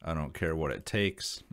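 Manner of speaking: usual speaking voice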